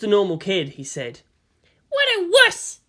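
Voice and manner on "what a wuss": put-on much higher, scornful girl's voice; scornful Asuka. A narrator's reading. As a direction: sneer it like a bratty teen girl. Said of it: "she thought".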